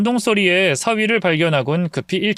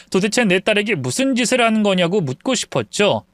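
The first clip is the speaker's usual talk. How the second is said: ordinary delivery, nothing marked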